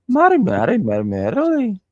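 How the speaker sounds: hammed-up Scouse, high and loud; Scouse accent. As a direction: squeal it in an exaggerated Scouse accent. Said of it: "trying to say that in a Scouse accent".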